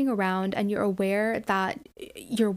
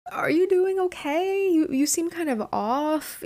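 higher, slower, gentle worried check-in; concerned friend; 8 semitones higher than usual. Retold or imitated. Leslie on the phone checking on a friend, higher and gentle.

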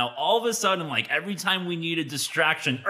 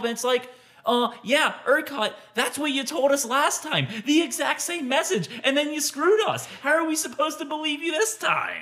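rising, higher and higher, fed-up complaint; exasperated rant; 9 semitones higher than usual. Real, not acted. Podcast host venting at the power company, exasperated. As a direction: climb in pitch, exasperated at ERCOT. Venting at ERCOT's broken promises.

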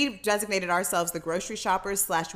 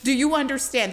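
deliberate, raised disbelief; 5 semitones higher, 3 dB louder than usual. Retold or imitated. Pleading with dispensary clerk.

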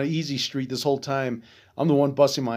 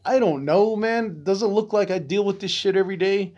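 snippy, pitched-up brush-off; being an ass; 6 semitones higher than usual. Retold or imitated. To the bank staff asking about ATM use, a snippy high brush-off. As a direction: play it like a bit of a jerk, voice up high. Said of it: "I just kinda wanted to be an ass".

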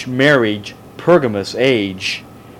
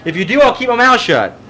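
challenging; 8 semitones higher, 4 dB louder, faster than usual. Real, not acted. Challenging the class.

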